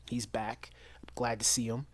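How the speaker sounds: soft unvoiced whisper; hushed happy aside. Real, not acted. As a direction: lean in and whisper it like a secret. Aside about actor returning.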